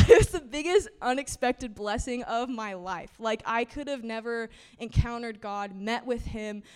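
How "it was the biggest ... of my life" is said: loud words riding on a laugh; laughing through emotion. Real, not acted. Speaker thanks the congregation, laughing to keep from crying. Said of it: "don't cry".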